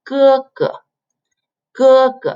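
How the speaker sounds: G as Mandarin "ge": high level first syllable, light second; "gē-ge" with a flat high tone, then a short light one. Shown as a demonstration. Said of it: "it's a flat tone".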